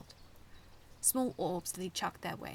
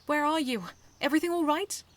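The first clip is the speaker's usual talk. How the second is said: worried calling; 8 semitones higher, 11 dB louder than usual. A narrator's reading. Snow answering over the radio.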